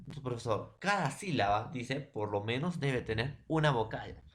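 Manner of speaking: ordinary talk, nothing marked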